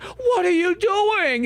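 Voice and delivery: high, slow mock outrage; mock Grace. Imitating Grace as Leon kills the monster, high and mocking. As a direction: squeal it like an upset Grace. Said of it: "she's like".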